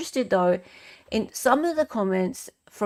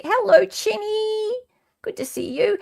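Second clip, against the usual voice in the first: silly high greeting; 12 semitones higher, 3 dB louder than usual. Pretend. Sing-song greeting to viewer.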